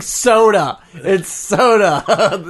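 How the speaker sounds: high, loud, laughing soda correction; a laughing, emphatic correction to the friend; laughing insistence. Retold or imitated. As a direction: insist through laughter, drawn out. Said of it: "And I'm just like".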